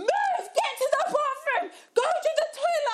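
very high, loud frantic orders; imitated shouting. Boyfriend ordering vomiting girlfriend to move, imitated shouting. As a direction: yell it like a hammed-up panicked boyfriend. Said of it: "He's shouting at me".

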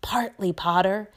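lightly whispered confidence. A narrator's reading. Malfoy confiding a scheme to Potter, whispering. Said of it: "lowering his voice to a whisper".

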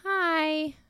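put-on very high, cute child's hi; drawn-out little squeak of a greeting; little kid's voice. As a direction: imitate a tiny kid saying hello. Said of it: "they hear a little kid's voice say".

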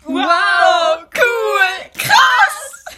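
put-on loud, high, thrilled kid shouts; children shouting in delight after landing; excited shouts. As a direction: yell like kids wowed by the sights. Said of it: "waren die Wörter, die die Kinder aussprachen".

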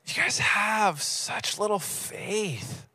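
slow, whispery sleepy scolding; sleepy Jesus. Acted. Sleepy Jesus chiding his disciples, drowsy whisper. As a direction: drag it out softly, half awake. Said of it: "He's kinda like sleepy Jesus".

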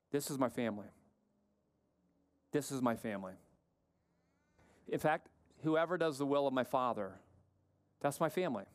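low, measured voice with long pauses. Retold or imitated. Jesus naming his followers as family, low solemn acted reply. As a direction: drop low and let each statement settle.

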